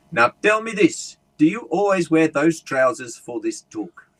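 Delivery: loud, slow South African heckler; South African accent. Retold or imitated. Big South African guy questioning the speaker from the back, South African accent. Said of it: "this big South African guy".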